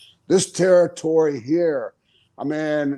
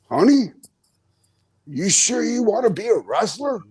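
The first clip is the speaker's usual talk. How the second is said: worried mom; 6 semitones higher than usual. Retold or imitated. Mom seeing her son's scraped face, higher worried voice. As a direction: lift the voice like a fretting mother. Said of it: "my mom looks at my face and she goes".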